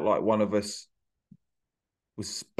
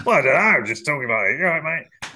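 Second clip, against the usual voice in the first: higher, louder blokey patter; put-on stage voice; 6 semitones higher, 3 dB louder, much faster than usual. Comedian answering his inner voice in put-on patter. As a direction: play a chummy club comic. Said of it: "Why are you talking like this?".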